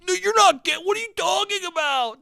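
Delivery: high-pitched, hammed-up twelve-year-old tantrum; mock an indignant kid losing it; angry mock outburst. Imagining his twelve-year-old self arguing with a cousin, angry mock outburst. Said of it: "I was so angry".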